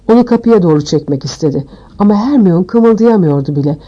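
ordinary delivery, nothing marked

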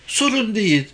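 put-on high little girl voice; small girl, pitched high; child character. A narrator's reading. Little girl replies to the man.

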